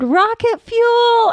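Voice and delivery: high, slow mock battle cry; retold scream. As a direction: yell it like a sprint finish. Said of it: "I screamed".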